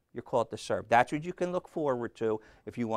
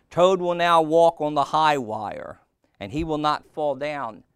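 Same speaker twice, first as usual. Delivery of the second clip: loud, raised stage announcement; strange announcer voice; 5 semitones higher, 9 dB louder than usual. A narrator's reading. Voice presenting Toad's high-wire act, loud announcer. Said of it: "said the voice".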